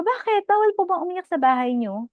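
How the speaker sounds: high-pitched, incredulous challenge; mock-indignant question. Acts own indignant reply to mom.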